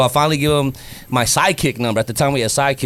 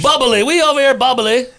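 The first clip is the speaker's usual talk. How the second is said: much higher, louder playful slang; higher louder teasing offer of a slang word; excited joke; 10 semitones higher, 5 dB louder than usual. Acted. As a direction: pitch the slang word with a burst of fun. Joking slang suggestion.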